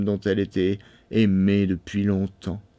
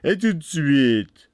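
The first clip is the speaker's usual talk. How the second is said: slow, raised, forceful command; stern Bluebeard; 5 semitones higher, 4 dB louder, slower than usual. A narrator's reading. Bluebeard demanding death at once, slow and stern. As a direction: drag out the order menacingly.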